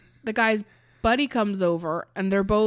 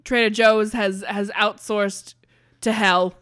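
booming JFK voice, much louder; loud JFK impression; 10 dB louder than usual. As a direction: proclaim it like JFK at a podium. Trader JFK confesses.

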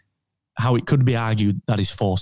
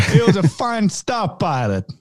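higher pitched Walken as Obi-Wan; Christopher Walken impression; 6 semitones higher than usual. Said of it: "Obi-Wan was played by Christopher Walken".

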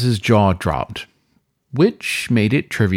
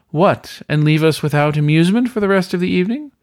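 brisk, higher amused host voice; amused weasel host; 9 semitones higher, 3 dB louder than usual. Acted. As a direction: tease him lightly, quickly. Weasel master laughs, speaks.

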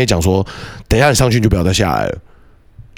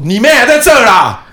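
mock shout; 11 semitones higher, 5 dB louder than usual. Kids shouting after fleeing boy.

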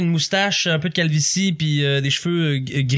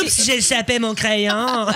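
coy, slow secretary with laughter; teasing sultry secretary; flirty secretary; 5 semitones higher than usual. Acted. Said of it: "la secrétaire".

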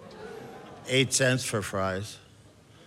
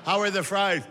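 asking about fries; 9 semitones higher than usual. Acted. Asking the fat-fed diner.

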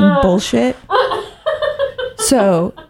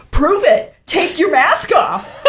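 higher, defiant retort; imitating the argument, raised; mock challenge; 8 semitones higher than usual. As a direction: dare her to prove it. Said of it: "And I'm like".

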